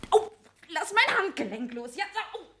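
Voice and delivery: high, strained cries of pain and alarm; frightened, hurting protests. Acted. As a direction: yelp as he twists your wrist. Man grips her wrist.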